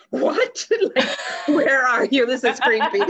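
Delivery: giggling through a mock creeped-out question; amused mock alarm with laughter breaking in; laughing disbelief. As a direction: laugh it out, half spooked and half amused. Laughing at hearing his voice.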